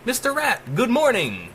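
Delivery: chirpy AI intercom saying good morning; AI over the intercom greeting the captive man, bright and high; AI announcer voice. A narrator's reading. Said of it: "the speaker announced".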